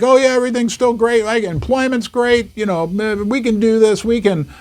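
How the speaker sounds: high, fast mock-upbeat officials; hammed-up carefree cheer; mock cheerful optimists. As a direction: parody the everything-is-fine crowd, breezy and quick. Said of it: "They're like".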